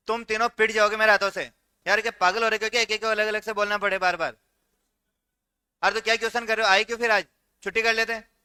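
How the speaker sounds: loud, exasperated teacher telling off the class; angry scolding. Real, not acted. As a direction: scold them loudly, fed up. Teacher scolding disruptive students. (गुस्से में ज़ोर से डाँटना)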